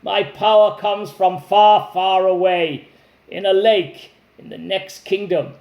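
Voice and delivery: dragon bragging about where his power hides; gloating dragon. Acted. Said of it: "the dragon laughed".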